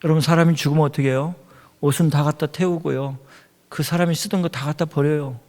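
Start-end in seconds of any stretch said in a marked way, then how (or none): none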